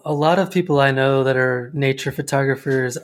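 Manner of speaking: ordinary talk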